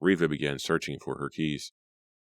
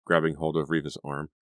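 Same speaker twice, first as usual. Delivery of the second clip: ordinary delivery, nothing marked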